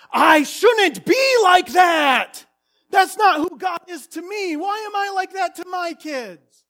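high, loud outburst, slow on the first line; self-reproach. Acted. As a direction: berate yourself in anguish.

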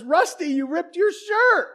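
high, slow, stern mom voice; scolding mom. Retold or imitated. Mom scolding Rusty about his ripped shirt, stern. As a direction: scold like a mother finding a torn shirt. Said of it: "his mom says".

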